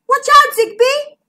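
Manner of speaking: high, loud cry of alarm; warning. A narrator's reading. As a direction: shriek an urgent warning. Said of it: "warned Bertie".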